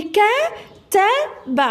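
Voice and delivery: imitating the child sounding out ك-ت-ب one letter at a time, slowly; mock slow spelled-out ك-ت-ب. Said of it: "راكي تقولي".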